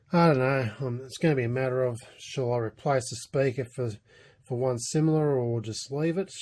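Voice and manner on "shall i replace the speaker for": ordinary delivery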